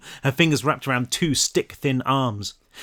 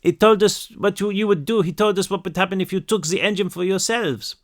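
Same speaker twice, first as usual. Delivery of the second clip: put-on high, pleading French-accented girl; upset French child, pitched high; tearful French accent; 7 semitones higher than usual. A narrator's reading. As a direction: protest in broken English with a French accent, near tears. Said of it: "She started to cry again".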